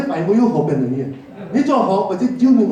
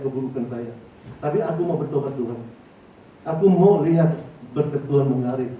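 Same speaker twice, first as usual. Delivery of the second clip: respectful, gentle thanks; 4 semitones lower than usual. Retold or imitated. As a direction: pray meekly and reverently. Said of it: "harus penuh hormat".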